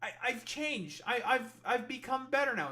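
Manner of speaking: high, pleading mock victim; whiny put-on voice of a shocked regretter. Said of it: "shocked Pikachu face and be like".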